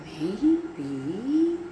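"Maybe" drawn out with a rising end; long, undecided, musing "Maybe"; pondering maybe. A narrator's reading. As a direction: stretch "Maybe" slowly, lifting at the end. Sorcerer ponders Tabitha's suggestion.

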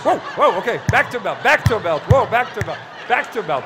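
high, loud urgent whoa; alarmed calling out. Real, not acted. To the panicking volunteer, loud and high in alarm. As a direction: call loud to stop the chaos. Chaos after snake attack.

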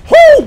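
high, loud whoop of hype; excited whoop. Real, not acted. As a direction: let out a cocky cheer. Hyped taunting the enemy.

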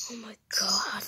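breathy shocked whisper; whispered gasp. Acted. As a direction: whisper it in dismay. Character gasps at an error.

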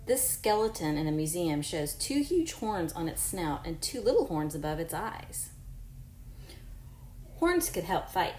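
clear, even read-aloud voice for a class; reading to children. Acted. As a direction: read the book to your pupils. Reads book aloud to class.

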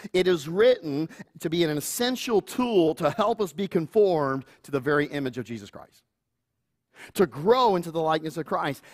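ordinary delivery

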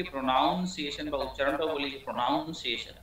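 প্রনাউন্সিয়েশন with a drawn "noun" in the middle; common mispronunciation. Shown as a demonstration. Teacher quotes a habitual mistake, saying প্রনাউন্সিয়েশন with "noun". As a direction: say প্রনাউন্সিয়েশন as pro-NOUN-see-ay-shun both times. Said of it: "ভুল করে লিখি".

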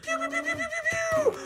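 high, rapid pew pew pew; a kid's sky-high laser blasts; laser pew sounds. Pretend. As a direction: fire off toy laser zaps way up high. Laser shooting in toy play.

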